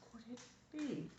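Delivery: very soft, hushed question; whispered. A narrator's reading. Lonely goat hears dancing hooves, whispered. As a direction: drop right down to a hush. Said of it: "whispered Fritz".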